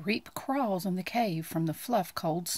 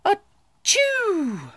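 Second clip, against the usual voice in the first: much higher, loud acted achoo; a big sudden sneeze; 13 semitones higher, 5 dB louder than usual. Bear sneezes.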